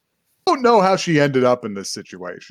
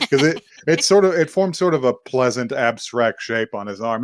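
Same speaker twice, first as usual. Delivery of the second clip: normal talking voice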